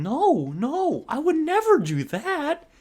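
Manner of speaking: high, slow, stretched-out overacted denial; Mark Wahlberg. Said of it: "Like fucking Mark Wahlberg from The Happening".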